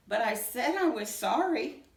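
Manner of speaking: usual speaking voice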